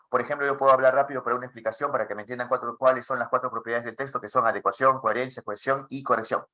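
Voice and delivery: rapid-fire explanation without pauses; very fast speech. Shown as a demonstration. Teacher demonstrating the other extreme, very fast. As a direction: race through it in one breath-like run. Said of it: "hablo muy rápido".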